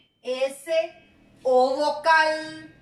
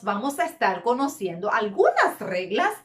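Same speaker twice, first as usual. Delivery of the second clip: ordinary talk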